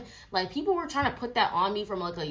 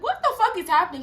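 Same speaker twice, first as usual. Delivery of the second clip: higher and louder, retelling her own stunned outburst; shocked disbelief; 10 semitones higher, 6 dB louder than usual. Retold or imitated. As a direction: blurt it out in shock. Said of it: "I was like".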